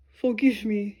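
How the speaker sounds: plain reading voice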